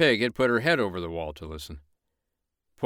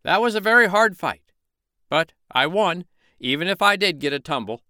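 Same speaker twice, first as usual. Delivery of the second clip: much higher, louder puffed-up boast; bragging rooster; 9 semitones higher, 5 dB louder than usual. Acted. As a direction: claim the victory loudly. Rooster claims he won.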